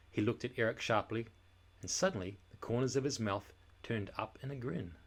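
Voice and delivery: ordinary delivery, nothing marked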